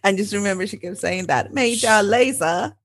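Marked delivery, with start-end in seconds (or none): 1.5-2.7 put-on slow, higher British accent. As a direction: imitate an English instructor's British accent. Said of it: "I don't really know my British accent very well".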